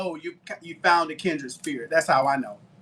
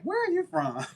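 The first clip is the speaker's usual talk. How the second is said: high, laughing mimic of a curious stranger; mock curious asker; 11 semitones higher, 5 dB quieter than usual. Asked about his accent, a light laughing high mimic. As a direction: toss it up lightly like a stranger asking. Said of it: "I'm like".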